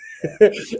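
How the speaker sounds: burst of laughter. Real, not acted. Laughs at Joe Smith's quip.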